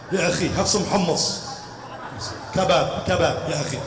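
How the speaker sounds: low, soft calming coo; calm him down gently; mock soothing. Comic calming a hot-tempered brother, soft coo.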